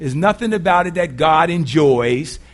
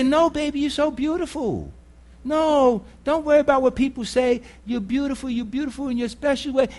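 tender parent; 5 semitones higher than usual. Retold or imitated. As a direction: hush a hurt child gently. Parent comforting a child.

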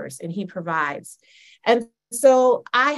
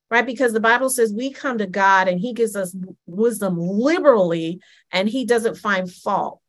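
usual speaking voice

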